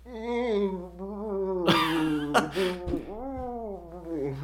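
high muffled groan behind a hand; mummy groan. Pretend. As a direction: moan like a mummy through covered mouth. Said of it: "your best mummy interpretation".

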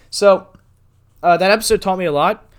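talking through a burp; a burp catches the words. Real, not acted. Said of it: "I'm sorry, I'm burping".

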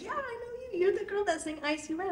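a hint of a chirpy cashier. Cashier telling her she knows the singer, light high voice. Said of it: "she was like".

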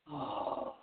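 breathy whispered oh of relief; relieved sigh. Retold or imitated. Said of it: "and you're like".

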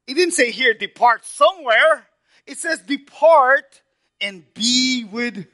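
high, loud, fervent preacher's cry, stretched slow; full-force preaching. Acted. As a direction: pour out Paul's words to the congregation at full force. Preaching the verse.

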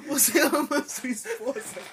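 slow, laughing mock questions. Real, not acted. Quoting kids' questions, laughing.

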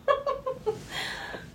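high unvoiced laugh through tears; teary laugh. Real, not acted. Reviewer tearing up over the film, a teary laugh. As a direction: let a tearful laugh slip out. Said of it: "I'm kind of tearing up now".

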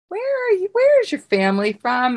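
high-pitched, eager questioning; acted-out excited question, voice leaping up; excited curiosity. Excitedly spotting a fellow Greek.

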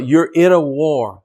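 slow and weighty, each word "You're in a war." leaned on; emphatic warning. Real, not acted. Preacher warning his flock, slow heavy emphasis. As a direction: drive home "You're in a war." to the congregation. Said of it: "That's my emphasis".

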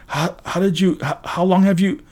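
high, halting flustered questions; stammering. A narrator's reading. Hero questioning friend who appeared, flustered stammer. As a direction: trip over each question, high-pitched. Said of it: "I stammered".

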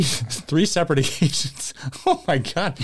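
slow, laugh-broken words of disbelief. Real, not acted. Laughs while reading the news.

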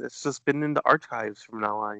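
usual speaking voice

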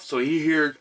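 normal talking voice

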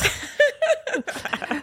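laugh. Real, not acted. Reacts to joke.

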